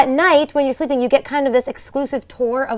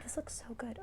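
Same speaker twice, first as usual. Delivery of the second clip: whisper; 25 dB quieter than usual. Real, not acted. Gasps at the frozen treat.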